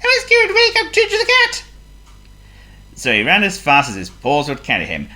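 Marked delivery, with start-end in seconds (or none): 0.0-1.6 very high, loud, urgent mouse voice; squeaky mouse. A narrator's reading. John Mouse deciding to wake the cat, urgent squeak. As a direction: squeal it like a determined little mouse.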